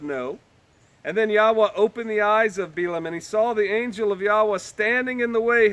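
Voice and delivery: measured, lifted Bible reading; solemn King James reading; scripture reading. Acted. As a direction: read the verses with gravity. Reads scripture aloud.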